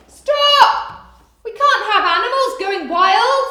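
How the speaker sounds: very high, loud stern command; shout. Acted. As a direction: halt the chaos loudly. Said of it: "said the vet".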